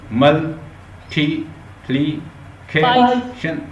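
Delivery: "Multiplication" drawn out very slowly, one beat at a time; syllable by syllable. Shown as a demonstration. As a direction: pause between each syllable of "multiplication". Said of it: "उतने वो syllable होंगे". ("Multiplication" धीरे-धीरे, हर syllable अलग)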